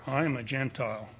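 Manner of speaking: usual speaking voice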